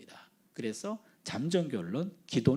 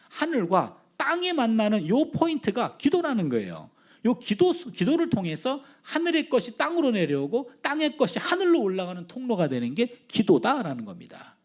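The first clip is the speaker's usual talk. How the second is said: raised, higher-pitched sermon delivery; emphatic preaching; 5 semitones higher, 5 dB louder than usual. Real, not acted. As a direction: drive the point home to the congregation. Preaches the main point to congregation. (힘주어 선포하는 설교)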